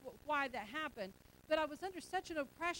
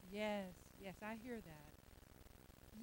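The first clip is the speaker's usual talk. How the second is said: much softer and very slow, mocking a kid who won't move; lazy drawling child; 7 semitones lower, 14 dB quieter, much slower than usual. Pastor mocks a lazy child answering mom, slow soft drawl. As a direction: drag it out like a child who has no intention of obeying.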